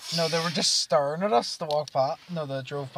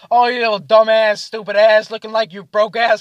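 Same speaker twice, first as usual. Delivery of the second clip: loud, raised-pitch string of insults; imitated roast; 6 semitones higher, 10 dB louder than usual. Drunk friends roasting each other at the table, mock-aggressive. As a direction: reel off mock insults with force. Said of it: "start roasting each other".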